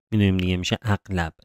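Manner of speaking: usual speaking voice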